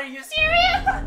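very high laughing disbelief; shrieking laugh. Real, not acted. Gamer disbelieving a teammate's blunder, shrieking laugh.

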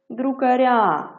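"друкаря" stressed on a long final "ря"; stress on the ending. Shown as a demonstration. Teacher shows pupils the moved stress in "друкаря", end stress. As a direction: draw out the last syllable of "друкаря", slow. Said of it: "Наголос у нас перейшов на закінчення".

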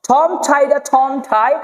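slow ঠম ঠাইডা with airy th stops; aspirated T. Shown as a demonstration. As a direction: puff air on every ঠ in ঠম ঠাইডা ঠন ঠাই. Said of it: "এইভাবে পড়তে হবে".

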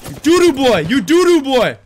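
high, loud mocking insult; loud taunt. Real, not acted. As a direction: jeer at the enemy player. Taunting an enemy in game.

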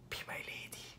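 ordinary talk

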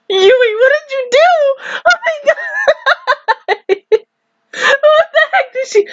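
high-pitched, cracking up in disbelief; laughing disbelief. Real, not acted. Fan scolding Yui over the guitar, laughing in disbelief. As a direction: squeal it through real laughter. Incredulous laughing reaction to anime scene.

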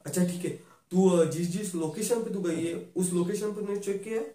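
ordinary talk, nothing marked

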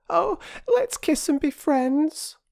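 put-on very high, slow childish voice; wheedling high-pitched child; pleading little girl. A narrator's reading. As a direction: coax him sweetly to make up. Little girl begs to make up.

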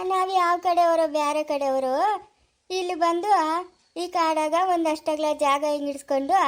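normal talking voice

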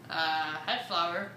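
low dopey voice. Acted. Low character voice.

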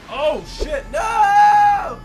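high, loud screaming cry of alarm; panicked shout. Real, not acted. Gamer screaming as the shark attacks him, panicked. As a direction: scream as the shark gets you.

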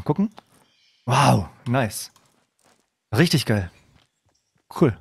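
usual speaking voice

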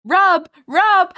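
loud, high-pitched exclamation; loud correction. Real, not acted. Correcting listeners who misheard the word, loud correction.

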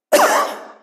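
one sudden loud cough; acted cough. Man waking up in the morning in the UK, acted cough. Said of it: "I wake up in the morning and I go".

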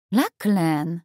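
a mild warning tone, saying his name. A narrator's reading. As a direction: caution him with one word. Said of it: "rzuciła ostrzegawczo Robin".

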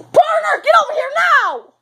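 high, loud urgent order; commanding shout. Pretend. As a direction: yell the order across the room. Sheriff orders his partner.

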